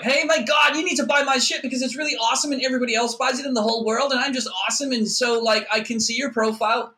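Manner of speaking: high, loud, fast mock sales pitch; pushy salesperson. LinkedIn seller pitching a brand-new connection, high gushing pushy parody. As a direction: gush at full tilt in a put-on high voice.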